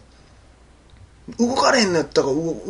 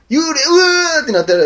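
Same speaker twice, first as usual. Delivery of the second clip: mock panic; 13 semitones higher, 5 dB louder than usual. Acted ghost appearing, hero panics.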